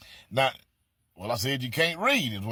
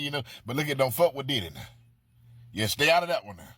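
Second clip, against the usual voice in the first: normal talking voice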